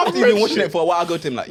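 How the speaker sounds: ordinary delivery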